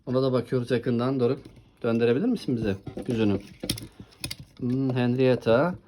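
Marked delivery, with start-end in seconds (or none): none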